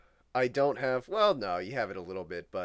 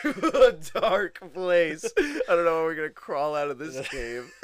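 higher, louder, through laughter; laughing; 8 semitones higher, 10 dB louder than usual. Real, not acted. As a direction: laugh while you say it. Laughing at own dark joke.

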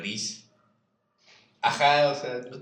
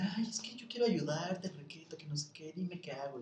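sweet pleading voice that trails off; eager aunt; 7 semitones higher, 4 dB quieter than usual. Retold or imitated. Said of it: "Ella dijo así como de". (tía insistiendo en ayudar, aguda y lenta)

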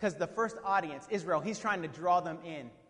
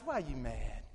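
lower, softer mock-divine question; God's voice; 9 semitones lower, 6 dB quieter than usual. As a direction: ask it low and quiet, as God. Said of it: "God says".

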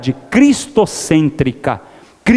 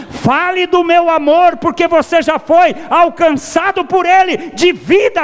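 much higher, different voice for God speaking; 9 semitones higher than usual. Acted. God tells the congregation to share his love, in a raised voice. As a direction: lift into God's own voice, commanding the listeners. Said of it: "pra dizer pra você e pra mim".

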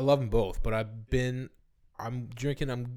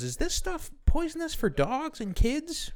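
put-on much higher, slow fussy old lady; slower than usual. Lady walking her dog asks the lawn crew, high and slow. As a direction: ask it like a worried old lady. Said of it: "then she goes".

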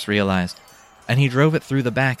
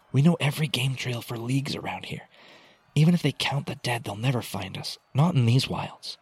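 higher, hushed persuasion; urging; 4 semitones higher, 3 dB quieter than usual. A narrator's reading. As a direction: reassure him that escape is possible. Rhea urges sergeant to escape, hushed.

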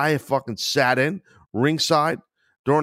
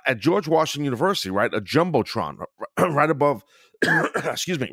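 normal talking voice

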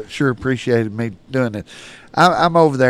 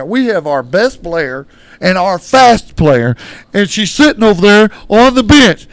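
insistent stranger; 6 semitones higher, 8 dB louder, slower than usual. Retold or imitated. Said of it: "he said".

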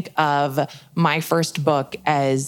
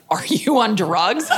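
higher, drawn-out mock disbelief; incredulous dad; 6 semitones higher, slower than usual. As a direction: imitate a dad's slow, incredulous question. Dad's incredulous answer to daughter.

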